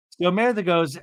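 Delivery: ordinary talk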